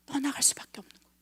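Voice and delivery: quick hushed whisper, trailing away. Real, not acted. Preacher tells the church the Spirit must leave, whispered fast. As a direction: let it slip out as a fast whisper.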